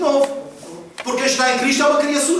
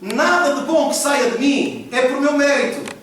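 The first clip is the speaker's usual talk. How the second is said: slow, deliberate preaching line; slow right down and let each word land; speaking slowly; 2 semitones higher, slower than usual. Shown as a demonstration. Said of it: "Eu vou falar devagar". (devagar e pausado)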